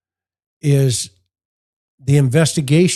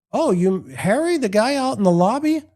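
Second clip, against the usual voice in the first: mock surprise; 9 semitones higher than usual. Detective feigns surprise.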